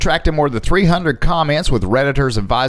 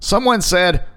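ordinary delivery

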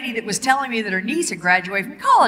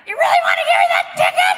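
fast, high, loud mock-flirty plea to the cop; 4 dB louder, faster than usual. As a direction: bat your eyelashes with your voice, pitched up and rushed. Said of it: "I'm like".

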